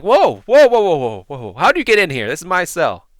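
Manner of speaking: loud, high-pitched startled shouting; panicked protest at full volume; alarmed. Acted. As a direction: yell like a prisoner finding an intruder. Prisoner startled by intruder in cell.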